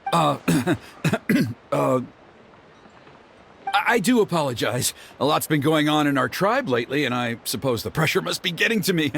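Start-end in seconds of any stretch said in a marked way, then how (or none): 3.7-9.1 flustered apology. Acted. Embarrassed father apologizes hastily.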